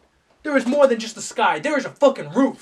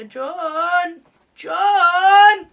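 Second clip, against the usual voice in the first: higher, drawn-out call, growing louder; mock a wife calling her husband; high nagging-wife call, building in volume; imitating wife calling; 8 semitones higher, 9 dB louder than usual. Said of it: "she was like".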